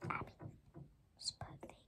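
very soft voiceless whisper; hushed private aside; whispering to self. Real, not acted. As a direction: mutter it in a whisper to yourself. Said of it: "I was just whispering to myself".

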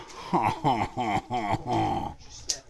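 hammy laugh. Pretend. Laughs at own joke in character.

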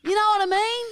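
hammed-up falsetto complaint; mock outrage. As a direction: squeal the accusation in mock indignation. Mock-outraged counter-accusation in argument.

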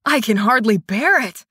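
theatrical fake agony; dramatic mock suffering. A narrator's reading. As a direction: ham it up like a tragic hero.

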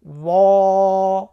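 ওয়া held long and loud, full and heavy; heavy Arabic waw. Shown as a demonstration. Teacher asking viewers to repeat the letter. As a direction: draw out ওয়া slowly and heavily. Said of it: "আমার সঙ্গে আপনারা পড়ুন".

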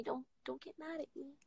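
a hushed, pleading "don't get mad at me"; soft plea. Real, not acted. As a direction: beg softly for forgiveness. Apologizes for not seeing everyone.

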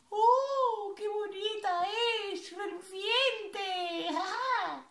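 high, delighted gasp; excited magpie. Acted. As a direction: marvel at something shiny. Magpie admires shiny house.

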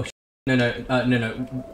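normal talking voice

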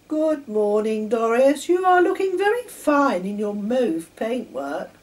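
a hint of a proud young engine; Sammy voiced. A narrator's reading. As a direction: puff up and greet her grandly. Sammy voiced proudly greeting Doris.

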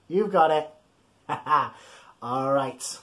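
normal talking voice

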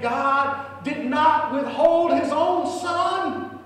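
high, loud, stretched-out preaching; full-force preaching. Acted.

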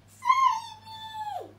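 very high, loud squeaky plea; high mock cry for help. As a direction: shriek for rescue like a tiny victim. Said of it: "cried the Grayberry".

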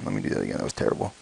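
low, soft voiceless mutter under the breath; muttered to self. Real, not acted. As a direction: mumble it to yourself after fumbling. Redoing coin flip.

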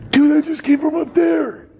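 high, loud startled yell; shocked shout. Real, not acted. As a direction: blurt it out in real shock. Reacting to a ghost sighting.

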